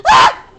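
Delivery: high loud shriek of dismay; sudden loud squeal. Real, not acted. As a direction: scream as you lose. Scream on failing.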